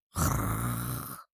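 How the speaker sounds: deep rumbling snore; loud snore. A narrator's reading. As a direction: snore loudly in your sleep. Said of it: "издал громкое". (громкий храп)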